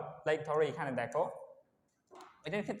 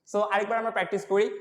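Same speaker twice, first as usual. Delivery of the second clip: ordinary delivery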